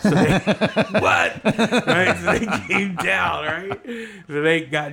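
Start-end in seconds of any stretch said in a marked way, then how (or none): 0.0-3.8 laughing. Real, not acted. Laughing at the guys' reaction.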